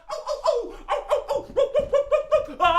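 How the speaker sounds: high, loud hound yelps; rapid volley of excited howling barks; excited dog howls. Pretend. Hound dog greeting his friends, howling excitedly. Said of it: "he's so excited".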